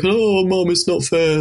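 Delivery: put-on high, whiny teenage complaint. Shown as a demonstration. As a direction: whine it like a sulky teen.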